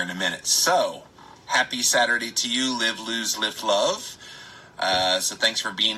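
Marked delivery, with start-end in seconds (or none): none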